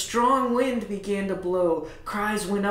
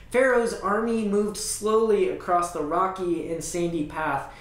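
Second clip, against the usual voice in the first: ordinary delivery, nothing marked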